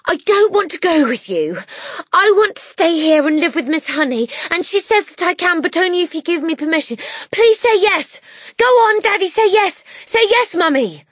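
put-on high, loud, urgent child's plea; breathless pleading. A narrator's reading. Matilda begs parents for permission to stay, breathless high pleading. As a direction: burst out begging, gasping for breath. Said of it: "gasping for breath".

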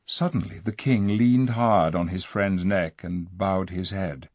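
ordinary delivery, nothing marked

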